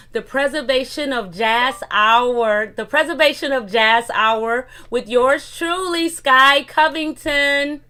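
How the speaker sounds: slow, loud showbiz announcement; grand announcer. Acted. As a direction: announce the title like a big show. Announcing show title.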